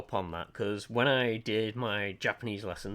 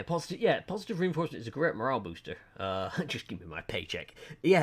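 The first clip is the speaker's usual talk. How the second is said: usual speaking voice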